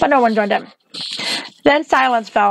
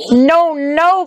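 high, distressed protest; crying out; 6 semitones higher, 3 dB louder than usual. A narrator's reading. Dorothy protests as Toto is taken, crying out. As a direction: cry out in mild alarm. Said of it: "cried Dorothy".

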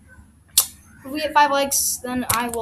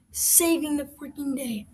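normal talking voice

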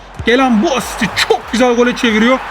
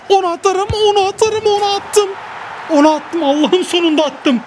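much higher, rising excitement as the shot goes in; excited goal cry; 7 semitones higher than usual. Real, not acted. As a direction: call the shot, then celebrate it going in. Finally scoring a goal.

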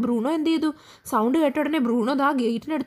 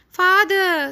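high, loud call for father; 5 semitones higher, 5 dB louder than usual. A narrator's reading. Sad Sachin calls to his father after Bruno runs off, raised call. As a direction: call out to Dad, stretching the word. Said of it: "Sachin called out".

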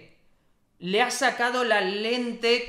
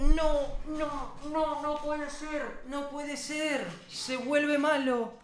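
slow, softening lament; dismayed disbelief; 3 semitones higher, 5 dB quieter, slower than usual. Real, not acted. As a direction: let it fade into stunned dismay. Dismayed as hero turns evil. (lamento lento e incrédulo)

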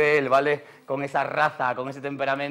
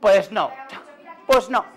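mock stern no; 4 semitones higher, 4 dB louder, slower than usual. Mock scolding of guest Ana.

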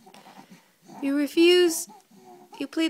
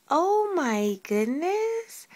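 ordinary talk

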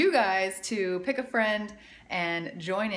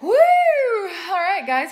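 much higher, louder laughing whoop; excited cheer; 11 semitones higher, 11 dB louder, much slower than usual. Real, not acted. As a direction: celebrate the finished set. Cheering after finishing the push-ups.

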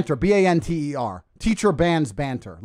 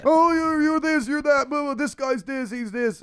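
mocking whine; 9 semitones higher, slower than usual. Mocking the troll's complaints.

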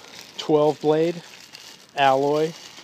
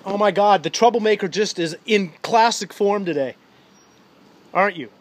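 excited exclamation; 7 semitones higher, 9 dB louder than usual. Real, not acted. Dad teasing mischievous child.

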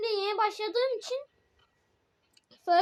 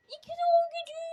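silly high voice; 10 semitones higher, much faster than usual. Pretend. Playful put-on voice.

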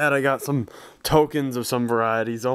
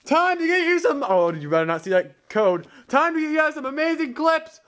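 hype announcer; 14 semitones higher than usual. Acted. Vlogger announcing clips to viewers, hyped. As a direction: sell it like a hype man.

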